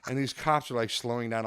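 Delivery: ordinary delivery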